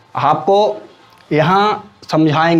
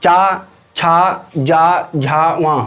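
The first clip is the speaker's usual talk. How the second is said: चा, छा said very slowly and drawn out; slow syllable demo; much slower than usual. Shown as a demonstration. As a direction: sound out चा छा जा झा वा one by one, slowly. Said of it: "तालव्य".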